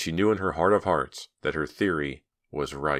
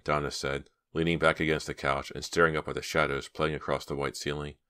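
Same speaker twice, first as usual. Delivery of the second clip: ordinary delivery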